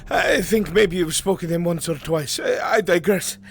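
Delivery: winded messenger. Acted. Runner telling the lord about a merchant, breathless. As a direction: keep panting as you explain.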